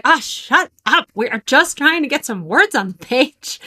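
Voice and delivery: talking back to her own doubts, irritated; exasperated retort. Retold or imitated. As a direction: swat the inner critic away, annoyed. Answering her inner gremlin.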